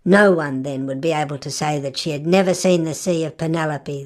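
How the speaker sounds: plain reading voice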